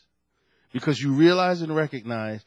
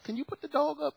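high, soft nervous plea; meek carpet cleaner asking the owner; timid request; 12 semitones higher, 6 dB quieter than usual. Retold or imitated. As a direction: ask politely while scared of the dog. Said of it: "I'll be like".

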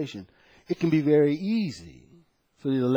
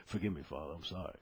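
much softer, humbled apology to God; hushed prayer; 11 semitones lower, 19 dB quieter, faster than usual. Retold or imitated. Preacher retelling his repentance to God, hushed and contrite. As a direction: barely voice it, head bowed in repentance.

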